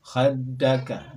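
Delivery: Khaddaka very slow, dd held; slow shadda demo. Shown as a demonstration. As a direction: stretch Khaddaka out. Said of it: "contoh pelafalan kami".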